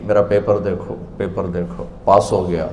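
soft, low acted boast; quiet retold news of passing; hushed announcement. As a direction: say it low, almost to yourself. Acts person showing exam paper.

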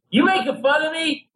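put-on high-pitched indignant drunk; drunken challenge. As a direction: imitate a drunk picking a fight. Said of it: "And he's drunk, and he's going".

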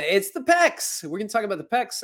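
"pecs" shot up high and loud with a rising tune; a squeaky high, thrilled "it's the pecs"; excited pecs. Shown as a demonstration. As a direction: announce "pecs" like a big reveal. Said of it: "repeated answer".

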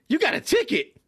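high, startled question; surprised supervisor. Retold or imitated. Imitating the supervisor hearing about the ticket, high and shocked. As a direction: sound shocked at the news.

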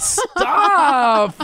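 high, loud laughing yell; laughing shout. Real, not acted. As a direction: yell stop through laughter.

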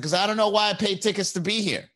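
high, whiny complaint; mock whiny heckler. Heckler complaining to the comedian, high and whiny. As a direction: whine the line like the heckler. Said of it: "It's like".

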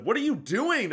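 high-pitched, imitating a taken-aback wife; retold wifely protest, pitched high; mock indignant protest. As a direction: voice jumps up in mock disbelief. Wife's protest in retold sex scene.

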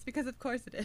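ordinary talk, nothing marked

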